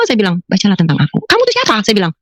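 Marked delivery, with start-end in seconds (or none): 0.5-1.2 low, calm answer; divine voice. Retold or imitated. Divine voice telling her what to read, low and calm. 1.3-1.7 indignant challenge. Retold or imitated. Woman demanding who the voice is, indignant.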